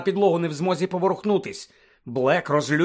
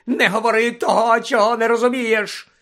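sudden shout; 7 semitones higher, 6 dB louder than usual. A narrator's reading. Snape suddenly shouting at Hermione like a madman. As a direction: explode at her like a madman. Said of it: "закричав раптом Снейп, мов божевільний".

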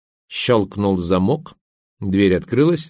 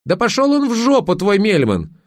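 angry squeal; 11 semitones higher than usual. A narrator's reading. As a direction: shoot the pitch up in outrage. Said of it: "взвизгнула Верка". (злобный визг)

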